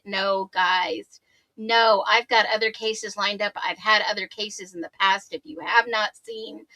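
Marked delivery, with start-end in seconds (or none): none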